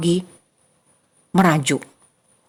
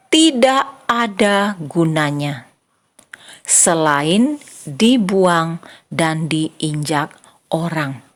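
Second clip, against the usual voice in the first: very slow, word-by-word quotation; slow, emphatic recitation; 3 semitones lower, much slower than usual. Shown as a demonstration. Preacher recalls the verse for children, slow and emphatic. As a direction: spell out each phrase with weighty pauses.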